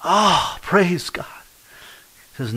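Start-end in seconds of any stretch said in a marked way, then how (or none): 0.0-1.3 high, bright burst of praise; overjoyed shout of praise; joyful cry. Retold or imitated. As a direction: cry out thanks to God. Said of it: "I heard him scream".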